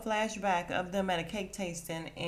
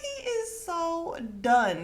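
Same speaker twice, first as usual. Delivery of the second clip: laughing; 10 semitones higher, slower than usual. Real, not acted. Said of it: "I'm like".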